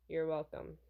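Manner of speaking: lightly voiced low-key bodyguard; stoic bodyguard. Bodyguard directing pop star to the car, lightly stoic.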